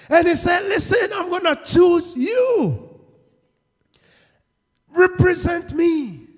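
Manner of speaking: slow, high, shouted proclamation; full-force preaching. Real, not acted. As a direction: proclaim it to the whole church.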